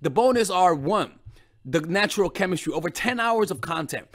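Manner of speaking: course promo. Acted. Coach advertising her course to women, calm promo read. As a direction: pitch the course calmly to viewers.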